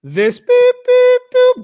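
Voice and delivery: high, loud bleeped-out curse words; censor beeps. Retold or imitated. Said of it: "let me translate what they said".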